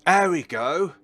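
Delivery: loud, high cheer of success; triumphant. Real, not acted. Player finally cracking a password lock, triumphant.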